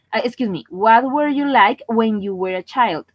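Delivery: ordinary talk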